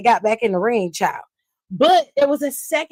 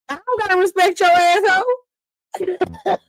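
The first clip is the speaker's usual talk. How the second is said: much higher, slow and put-on; mock defiant voice; 8 semitones higher than usual. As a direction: drag it out in a pitched-up, put-on voice. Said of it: "She was like".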